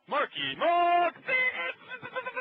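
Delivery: high, slow drawn-out ring announcer call; old-timey boxing announcer. Retold or imitated. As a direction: stretch the name like a boxing MC. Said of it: "like an old-timey boxing announcer".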